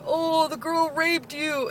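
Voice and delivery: high, slow, sing-song gamer taunt; mocking drawn-out jeer; taunting teenage boy. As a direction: imitate a gloating teen in the lobby. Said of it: "this guy's just going off like".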